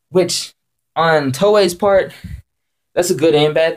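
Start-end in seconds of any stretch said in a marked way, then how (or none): none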